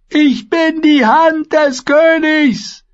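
very high, drawn-out loud shout; desperate scream. A narrator's reading. Prisoner proclaiming himself Hand of the King, screaming. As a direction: scream your rank after them. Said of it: "schrie der Gefangene ihn hinterher".

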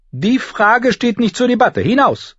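high, forceful governess command; stern dismissal. Acted. Septa refuses debate and orders girl out, sternly. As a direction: shut the argument down in a high, sharp voice.

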